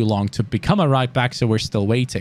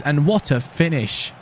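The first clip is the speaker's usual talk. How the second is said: ordinary talk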